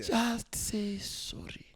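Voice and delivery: put-on high, slow, whispered Tutu accent; Desmond Tutu accent. Tutu pleading with Winnie to apologise, whispered high Tutu accent. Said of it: "a Tutu accent".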